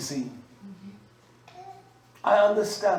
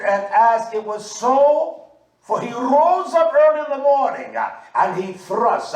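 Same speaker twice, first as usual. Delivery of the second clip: preaching scripture; 6 semitones higher, 6 dB louder than usual. Acted. Preacher reading scripture aloud.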